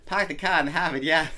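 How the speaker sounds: jokey Boston accent on the edge of laughing. Shown as a demonstration. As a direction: attempt Boston, dropping the r's. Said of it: "trying to work on my Boston accent".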